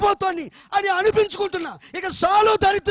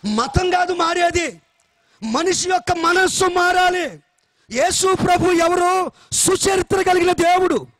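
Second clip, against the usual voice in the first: forceful preaching; 4 dB louder than usual. Acted. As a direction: drive each point home to the congregation. Preaching to congregation.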